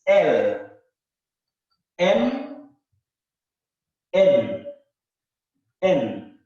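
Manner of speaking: letters called out loud, one by one; letter recitation. Shown as a demonstration. Teacher calling out letters loudly for students. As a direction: announce each letter firmly.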